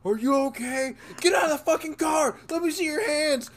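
high, barking cop commands; police officer shouting at driver, high and harsh. Retold or imitated. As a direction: yell like a cop at a window. Cops yelling at passed-out driver.

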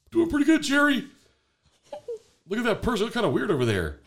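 high, squeaky cartoon fish; high silly fish voice. Dad voices second fish.

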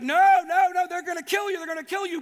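high, loud panicked pleading; alarmed protest. Retold or imitated. Disciples begging Jesus not to return, loud and panicked. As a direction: beg him not to go, voice up high.

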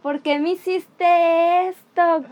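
high, drawn-out hysterical wail, not full volume; mock tearful scream. Friend cornered in the kitchen screams at her, mock hysterical wail. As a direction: imitate a frantic friend wailing in accusation. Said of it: "llorando y gritándome".

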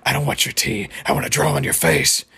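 creepy doll, dropping to a low growl; refuse, then sink into a deep menacing rasp; Percy. Retold or imitated. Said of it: "in a very creepy voice".

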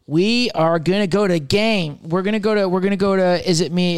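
usual speaking voice

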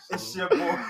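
slow, high, laughing it out. Real, not acted. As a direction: crack up while saying it. Laughing through the intro.

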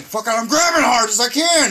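exasperated retort. Retold or imitated. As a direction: protest it, straining with effort. Retells exasperated reply during the act.

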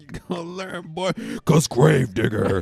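loud, slow hyped announcer; monster truck ad. Pretend. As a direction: drag it out like a promo. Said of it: "monster truck ad voice".

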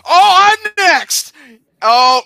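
high loud excited outburst; excited shout. Real, not acted. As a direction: cheer it out. Excited about his turn.

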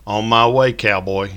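loud playful shout down the stairs. A narrator's reading. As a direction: call out teasingly from the stairs. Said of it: "Missy shouted".

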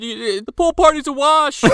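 high, frantic mock panic; panicked Cameron. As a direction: squeal it out like a panicking teen. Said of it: "Cameron's like".

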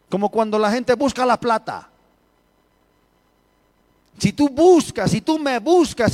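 high, loud preacher's cry, pressing each phrase; fiery preaching. Acted. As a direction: preach it out to the congregation at full force. Preaching at full force. (predicando con fuerza, voz alta y elevada)

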